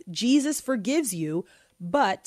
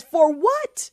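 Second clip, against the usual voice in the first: high, loud incredulous retort; reading a listener's email in mock outrage; 9 semitones higher, 6 dB louder than usual. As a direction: toss it back in mock disbelief. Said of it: "I just want you to feel this sister's outrage".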